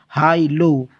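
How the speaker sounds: ordinary talk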